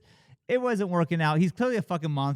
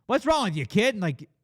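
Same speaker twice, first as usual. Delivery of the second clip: raised, louder imitation of a boss yelling; mock yelling; 7 semitones higher, 3 dB louder than usual. Boss yelling at a kid, mock shout. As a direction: bark at the kid, hammed-up. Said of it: "starts yelling at this kid".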